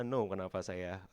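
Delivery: normal talking voice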